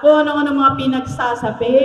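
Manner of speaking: normal talking voice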